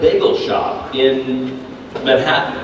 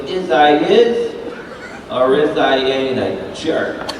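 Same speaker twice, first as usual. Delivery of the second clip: put-on slow, drawn-out New York accent; New York kid; slower than usual. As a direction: imitate a little New Yorker sizing himself up. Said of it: "in that New York accent".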